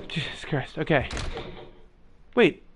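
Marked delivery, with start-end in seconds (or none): 0.1-1.1 whispered in relief after a scare; breathless whisper. Real, not acted. Gamer recovering from a scare, whispered. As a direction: breathe it out under your breath. Startled in horror game.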